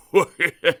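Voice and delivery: loud high snigger; sniggering laugh. Acted. Elephant sniggering at Mister Dizzy. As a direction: snort with mean glee.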